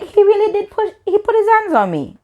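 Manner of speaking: very high-pitched disbelief; incredulous. Retold or imitated. Realising the guy really pushed her, high incredulous re-enactment. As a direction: go squeaky with re-enacted outrage.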